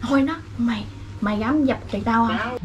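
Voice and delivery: very soft, feeding a line; hushed prompting. Real, not acted. Friend prompts the caller what to say, hushed. Quietly feeding lines during call.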